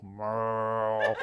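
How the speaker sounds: low, soft, drawn-out cattle noise; subtle moo. Shown as a demonstration. Traveler winding through the line like cattle, subtle moo. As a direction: imitate a cow under your breath, held on one low note. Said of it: "I would do the subtle".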